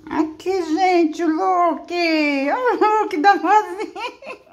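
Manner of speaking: high, loud gushing with laughs. Real, not acted. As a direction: coo over the dog, laughing. Mostrando o cachorro, rindo. (falando com voz de vó babona, aguda e alta)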